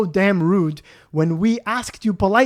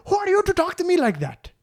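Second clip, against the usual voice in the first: outraged customer; 13 semitones higher than usual. Retold or imitated. Customer again.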